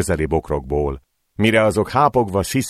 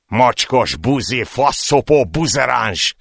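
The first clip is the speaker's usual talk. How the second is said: slow, gritted-teeth slurs, a bit higher; through gritted teeth; 6 semitones higher, slower than usual. Acted. Thugs spitting slurs while kicking the man, gritted teeth. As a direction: grind out the insults through clenched teeth. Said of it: "ismételgették közben összeszorított fogakkal".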